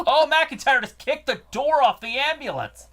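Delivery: high, loud disbelieving shout; shocked outburst. Real, not acted. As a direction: yell it in sudden shock. Door kicked off ambulance.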